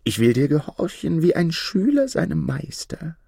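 high-pitched storybook fox. A narrator's reading. Fox promising the minstrel to obey, high creature voice. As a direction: pledge obedience in a high fox voice. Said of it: "antwortete der Fuchs".

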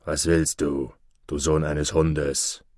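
low, slow surly wolf growl; grumpy wolf. A narrator's reading. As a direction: snap at him like a grumpy wolf. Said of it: "fuhr ihn der Wolf nach einer Weile mürrisch an". (mürrischer Wolf, tief und langsam)

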